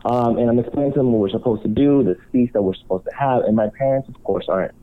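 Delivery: normal talking voice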